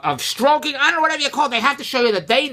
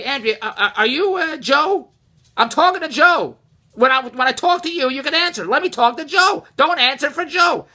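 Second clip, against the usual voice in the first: higher and louder, firmly telling his wife to let Joe answer; firm reproach; 7 semitones higher, 4 dB louder than usual. Retold or imitated. Husband stopping his wife from answering for Joe, firm and louder. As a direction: raise your voice a bit, sternly correcting her. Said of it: "I wanted to say".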